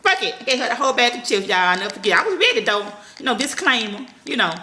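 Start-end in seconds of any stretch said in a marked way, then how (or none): 0.0-0.3 high exasperated toss-off; just give up, pitched way up; giving up. Retold or imitated. Mother finally handing over the chips, high and resigned. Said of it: "I'm like".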